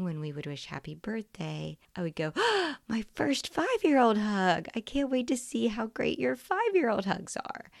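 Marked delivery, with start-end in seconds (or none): 2.4-2.8 big high delighted gasp; excited gasp. Retold or imitated. Mother greeting her birthday child with a high gasp. 2.9-7.7 higher, louder gushing joy; excited greeting. Retold or imitated. Mother greeting her child on the birthday morning, excited and higher.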